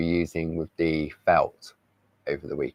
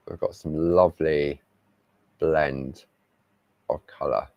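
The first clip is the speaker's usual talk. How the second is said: ordinary talk, nothing marked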